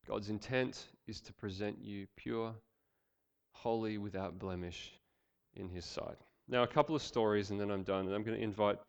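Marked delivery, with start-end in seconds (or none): 0.1-6.2 hushed reverent preaching. Acted.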